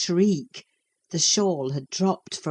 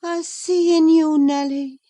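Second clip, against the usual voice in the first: high, light and far away, as if half asleep; young woman murmuring from a fever dream; dreamy Catherine; 11 semitones higher, 8 dB louder than usual. A narrator's reading. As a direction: drift through the words like a dream. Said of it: "she continued dreamily".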